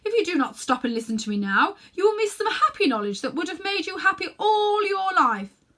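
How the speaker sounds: loud, coaxing witch voice, pressing hard; a witch luring the boy with promises; tempting witch. Acted. Witch tempting the boy to eat the apple, loud and coaxing.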